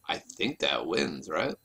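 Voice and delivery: ordinary talk, nothing marked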